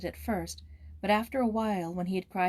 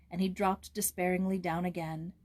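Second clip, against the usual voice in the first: ordinary delivery, nothing marked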